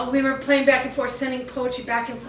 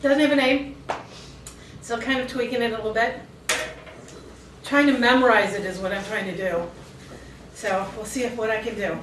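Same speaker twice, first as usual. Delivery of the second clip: usual speaking voice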